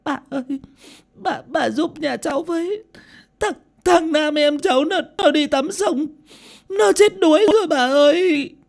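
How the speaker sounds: put-on very high, wailing, stammering girl; bursting into sobs. A narrator's reading. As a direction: sob out the terrible news, begging for help. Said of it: "thì bật khóc nức nở".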